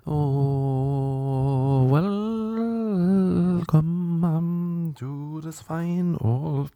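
slow, high, grand show-host voice; a hammed-up posh announcer welcoming listeners; mock posh host. Mock grand podcast host opening.

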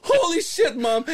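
high-pitched mock alarm at mom; acted-out startled cry; retold shock. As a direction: exclaim it high, reliving the shock. Retold shock at mother's fall.

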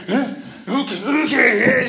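startled, then rushing to obey; giddy, flustered agreement; flustered eager okay. Acted.

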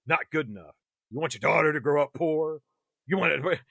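lightly voiced smooth devil talking down; a hint of a coaxing demon; Darkling voice. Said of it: "The Darkling says".

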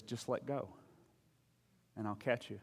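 soft, unhurried retold voice of God; God calling down. God answers the hanging man, softly voiced reassurance. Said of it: "God calls down in an audible voice".

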